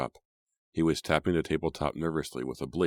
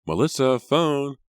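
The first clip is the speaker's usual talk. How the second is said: much higher, louder call up the stairs; calling from downstairs; 11 semitones higher, 7 dB louder, slower than usual. A narrator's reading. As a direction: call her from below. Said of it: "mother's voice calling from downstairs".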